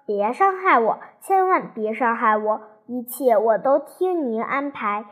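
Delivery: ordinary delivery, nothing marked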